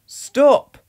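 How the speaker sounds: loud, high sharp stop; shout. A narrator's reading. Ted catches a burglar and shouts loudly. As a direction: bark it at the burglar. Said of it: "shouted Ted".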